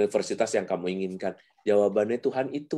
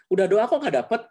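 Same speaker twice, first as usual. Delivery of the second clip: higher, reproachful question; a touch of an accusing parent; 11 semitones higher, 4 dB louder than usual. Retold or imitated. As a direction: press the child with blame. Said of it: "kalau pertanyaannya gitu". (sedikit menyalahkan, nada tinggi)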